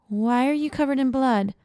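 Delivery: put-on high-pitched wary boy; small, distrusting voice. A narrator's reading. Armed boy questioning bloodied stranger, small distrusting voice. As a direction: question them as a suspicious child. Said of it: "a small, distrusting voice".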